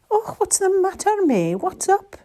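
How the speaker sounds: high, loud concerned question; Mrs Claus asking. A narrator's reading. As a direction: ask a troubled pet what's wrong. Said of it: "she asked".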